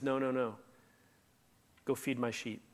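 low and soft, kindly; gentle reassurance. Acted. Jesus reassuring Peter after the failure, soft and kind. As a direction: quietly reassure a friend who failed.

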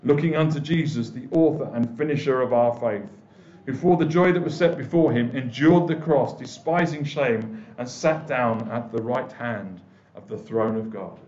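measured, solemn Bible reading; steady pulpit reading of scripture. Acted. Reads scripture passage.

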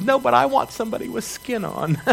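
put-on high, soft little-girl plea; a small child insisting she wants a real person; scared girl telling her mother she wants someone with skin on, high and soft; little girl pleading. Child's punchline reply.

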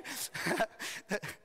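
quiet unvoiced giggle; breathless laugh. Real, not acted. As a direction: laugh at your own joke under your breath. Laughing at own joke.

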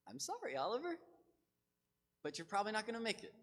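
high, lightly sorry tone to the pet; mock apology to cat. Preacher mock-apologizing to his cat, high voice. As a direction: apologize to the cat, half-joking.

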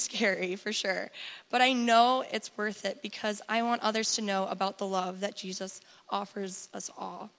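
ordinary delivery

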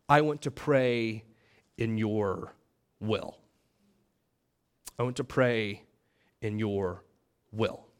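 low, soft and slow, pausing between phrases; voiced prayer, dropped low and soft; hushed prayer. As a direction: pray it quietly and surrendered, phrase by phrase. Acting out a humble prayer.

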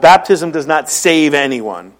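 ordinary delivery

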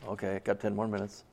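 low, soft, weary resignation; bored clock-watcher. Acted. Bored volunteer watching the clock, weary and low. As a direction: sigh and count down the chore.

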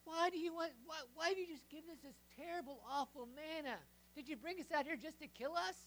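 loud, high griping at Moses; whiny complaint. Acted. Israelites griping at Moses in the wilderness, loud whiny complaint. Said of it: "they complain".

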